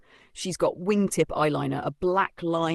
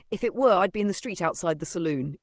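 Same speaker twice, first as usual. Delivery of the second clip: usual speaking voice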